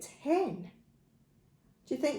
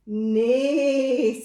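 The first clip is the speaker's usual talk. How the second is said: louder whinnying neigh; horse neigh; 8 dB louder than usual. Acted. Horse's line in a farm story, neighing. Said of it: "said the horse".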